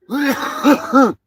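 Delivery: real high, loud laugh. Real, not acted. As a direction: burst out laughing at your own joke. Laughs at own mock ad.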